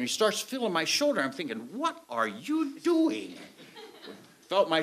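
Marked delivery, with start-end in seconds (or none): 1.7-3.3 puzzled. Retold or imitated. Puzzled thought during pat down.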